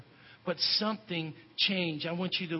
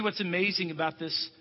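normal talking voice

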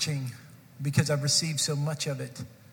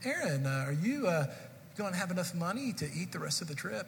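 soft, motherly concern, much softer; kindly asking; 8 dB quieter than usual. Retold or imitated. Youth sponsor kindly asks the boy about money, soft and motherly. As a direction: gently check on a teenager like a caring mother. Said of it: "She asked me very kindly".

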